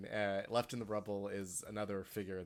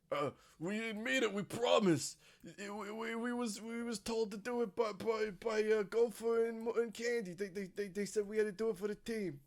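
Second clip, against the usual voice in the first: put-on higher, stammering scared teen voices; nervous high schoolers; 10 semitones higher, 4 dB louder than usual. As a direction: plead like panicked jocks caught out. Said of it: "the two of them ... say".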